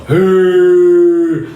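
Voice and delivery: long, loud held-out "hey"; mock voice of God. Preacher mocks how people think God sounds, mock divine call. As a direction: stretch it like a booming mock heavenly call. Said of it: "God so and so".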